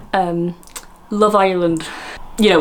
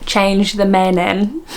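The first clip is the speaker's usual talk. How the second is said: slow, drawn-out mock Essex; much slower than usual. Host mimicking the Essex woman's clip, slow mock Essex. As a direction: exaggerate an Essex accent, stretching it out. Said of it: "can't do an Essex accent".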